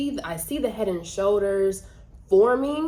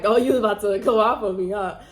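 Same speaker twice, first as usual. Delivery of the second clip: mock teasing, a little louder and quicker; teasing laugh; 6 dB louder than usual. Mom teasing her daughter, laughing. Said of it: "she starts laughing".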